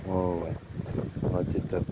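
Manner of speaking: soft, low cooing to a dog; talking to a dog. Real, not acted. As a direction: coo to the good dog. Petting the dog Titus.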